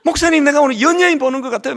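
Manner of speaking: fast, loud fan-like gush; mock starstruck member; excited gushing. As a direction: rush it out, thrilled. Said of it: "어떤 분은 막".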